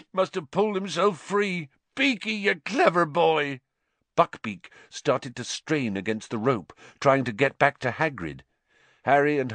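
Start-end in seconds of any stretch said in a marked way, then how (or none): 0.1-3.6 high, tearful elation; sobbing with joy. Acted. Hagrid praising his escaped pet, joyful sobbing. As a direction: praise him through happy sobs. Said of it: "Hagrid's words through his sobs".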